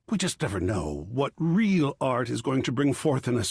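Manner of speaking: ordinary talk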